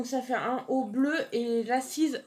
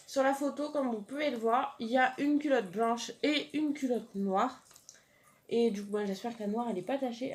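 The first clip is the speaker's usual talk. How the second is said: usual speaking voice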